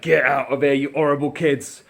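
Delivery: put-on loud, nasty shout at kids. Shown as a demonstration. Teacher showing a nasty character, loud and mean. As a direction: bark it like a mean grump. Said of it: "would suggest a not very nice person".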